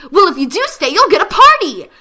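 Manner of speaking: high, loud gleeful promise; party pony's delight. Acted. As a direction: bubble over with glee. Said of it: "she said with glee".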